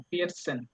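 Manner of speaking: ফিয়েটসেন with the t barely heard and quiet; soft German t. Shown as a demonstration. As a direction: blur the t in ফিয়েটসেন. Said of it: "মানে slightly উচ্চারণ হবে".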